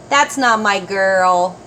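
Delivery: plain reading voice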